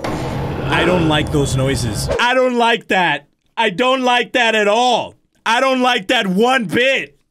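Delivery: slow, drawn-out words, each one weighted; slow emphatic objection. Real, not acted. Player unsettled by the noises, slow emphatic objection. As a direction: object firmly, dragging every word out.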